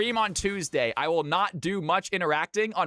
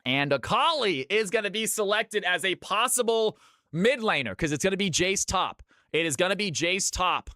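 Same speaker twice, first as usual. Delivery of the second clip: higher, building caster call of the draft; hype caster play-by-play; 5 semitones higher than usual. Acted. Casting the final CFC night to the stream, hype play-by-play. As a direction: call the matchup like a tournament caster.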